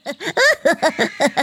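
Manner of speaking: high witch cackle, falling; high evil witch cackle. Acted. Witch cackles after the shout.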